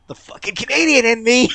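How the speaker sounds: loud, high cry of exasperation; high, loud self-directed cursing; exasperated outburst. Real, not acted. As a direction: let it burst out at full volume. Exasperated at own Canadian urge.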